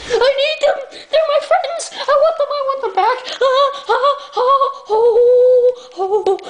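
high, squealing, half-laughing plea; obsessed fan voice. Acted. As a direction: shriek it like a crazed superfan.

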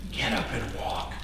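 soft breathy command; hushed command. Acted. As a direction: drop to a hush. Jesus commands, dropped soft.

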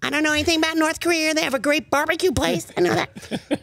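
high, fast folksy Palin; Sarah Palin impression.

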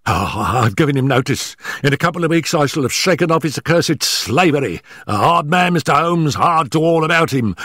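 high, hurried, stammering nervous man; gasping, out of breath. Acted. Nervous manager warning Holmes before his employer arrives, gasping and hurried. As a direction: blurt it out in short frightened bursts. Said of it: "our visitor gasped".

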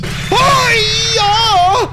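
very high, loud drawn-out shriek; startled shriek. Real, not acted. Player hit by a sudden scare, startled shriek. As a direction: scream out, pitch shooting up.

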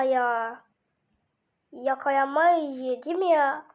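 ordinary talk

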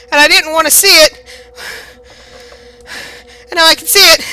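high, loud frantic babble; panicked. Real, not acted. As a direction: rant in panic. Explaining while hunted.

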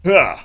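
sharp effort grunt; short strained huh. Real, not acted. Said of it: "Why are you making Roddy sounds".

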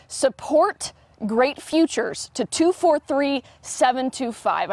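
slow, spaced-out words and digits; slow dictation. Real, not acted. Reporter gives viewers the text code, slow and careful. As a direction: spell it out slowly for note-takers.

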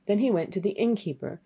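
ordinary delivery

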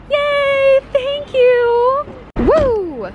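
high, loud squealing cheer; loud high joyful whoops; excited cheer. Real, not acted. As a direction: cheer like you just won. Cheering, celebrating the haul.

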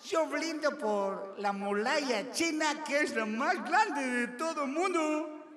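slow, high, grand toast; Chinese man's toast. Retold or imitated. Small Chinese man toasts the Great Wall, slow and proud. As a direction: proclaim it slowly and proudly. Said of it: "un chinito por acá con personalidad".

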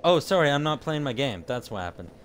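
ordinary delivery

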